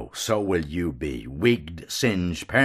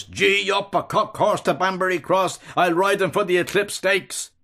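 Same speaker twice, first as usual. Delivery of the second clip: hard voice; 10 semitones higher than usual. A narrator's reading. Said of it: "calls in a hard voice".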